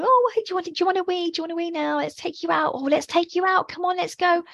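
high, gushing puppy-talk; overexcited coaxing of the puppy; sing-song fuss over a puppy; excitable fuss. Retold or imitated. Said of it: "I wouldn't be going".

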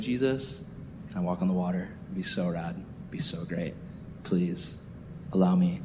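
low, soft little request; softly begging a favour; hushed pleading. Retold or imitated. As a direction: plead quietly, like a small prayer. Imagined shy plea to Jesus.

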